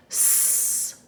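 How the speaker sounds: a long voiceless hiss, sss; hissing s sound. Shown as a demonstration. Teacher models the S sound, a drawn-out hiss. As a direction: hold out a soft hiss of S. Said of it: "like S, snake".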